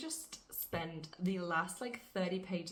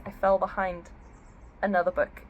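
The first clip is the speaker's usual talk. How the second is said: normal talking voice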